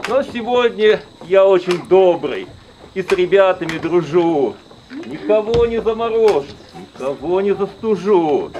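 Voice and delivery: put-on jolly old grandfather voice; booming fairy-tale grandfather; Ded Moroz voice. Ded Moroz character speech.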